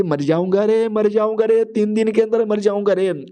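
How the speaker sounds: ordinary delivery, nothing marked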